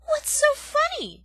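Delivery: high, loud indignant question; indignant demand. Acted. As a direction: demand to know. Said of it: "demanded Poppy".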